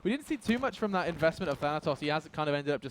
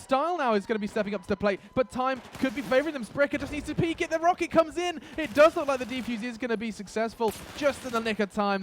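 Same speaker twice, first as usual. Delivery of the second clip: higher, louder, racing hype; excited play-by-play; 7 semitones higher, 6 dB louder than usual. Real, not acted. Caster calling a tense bomb defuse to viewers, excited. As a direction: ride the clutch moment at full hype.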